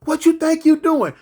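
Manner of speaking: mock furious shouting; mock hollering threats. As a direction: yell it like a jail fight. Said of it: "a bunch of hollering".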